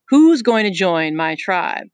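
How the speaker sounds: chief Jack calling out slowly; lording it over the boys; slow, loud challenge. Acted. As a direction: draw it out loud to the crowd.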